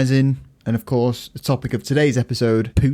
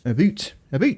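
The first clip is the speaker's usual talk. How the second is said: Canadian about; 4 semitones higher than usual. Retold or imitated. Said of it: "The Canadian accent".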